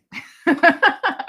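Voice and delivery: loud, high burst of laughter; laughing. Real, not acted. Host cracking up after quoting a song, loud laughter. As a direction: crack up at your own joke.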